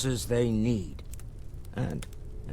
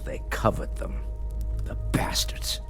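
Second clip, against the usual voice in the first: muttered under breath; 9 semitones lower than usual. Acted. Bitter muttered aside about rivals.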